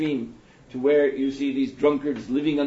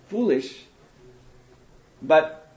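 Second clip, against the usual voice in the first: normal talking voice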